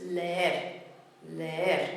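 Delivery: leer as two syllables, le-er. Shown as a demonstration. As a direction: say leer as le then er, kept apart. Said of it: "keep it as those two syllables".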